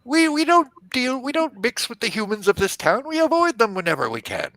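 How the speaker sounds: high, pleading fey voice; small fey creature. Acted. Captured fey pleads innocence to the knight, high and aggrieved.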